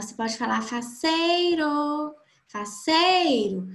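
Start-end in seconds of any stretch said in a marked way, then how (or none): 0.6-2.1 higher, drawn-out call on the word. Shown as a demonstration. Teacher calls the word to find its stressed syllable, calling out. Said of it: "você pode chamar a palavra". 2.5-3.7 slow call, leaning on SEI. Shown as a demonstration. Teacher calls the word to show the strongest syllable, calling out. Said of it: "você pode chamar a palavra".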